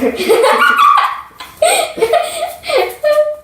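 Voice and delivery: high squealing laugh; shrieking laugh. Real, not acted. Laughing at shirt mishap.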